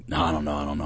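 low, soft imitation of hesitation; understated reluctant quote; retold reluctance. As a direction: balk quietly, as the unwilling friend.

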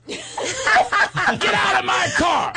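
very high, drawn-out mock scream; slow, high-pitched shouted order; imitated yelling. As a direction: stretch out each word in hammed-up fury. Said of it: "He starts yelling at me".

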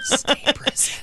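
breathy whispered punchline. Acted. Cohost whispers the answer to the S P riddle.

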